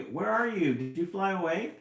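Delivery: high, loud anxious call; mother bird calling loudly for her chick; crying out. A narrator's reading. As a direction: call out for her lost baby. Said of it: "cries Mama J".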